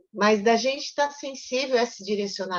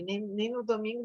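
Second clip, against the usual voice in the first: normal talking voice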